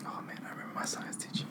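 fast, hushed whisper. Real, not acted. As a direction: whisper it quickly. Whispering back.

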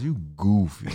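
You, then a pause, then goofy; pause before goofy. Retold or imitated. Imitating an audience member praising the comic with a pause. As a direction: leave a beat after You before goofy. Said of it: "they gotta get that pause".

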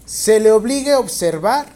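loud, slow dictation in chunks. Real, not acted. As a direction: dictate slowly and clearly, projecting to the class. Teacher dictating exam question to students.

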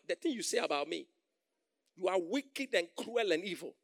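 ordinary delivery

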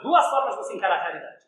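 ordinary talk

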